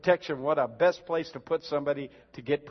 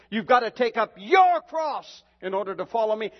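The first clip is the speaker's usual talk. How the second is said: louder and higher, thundering the call; shouted repeat; 5 semitones higher, 5 dB louder than usual. Retold or imitated. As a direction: hammer the quote home to the congregation. Repeats Jesus' words, shouted.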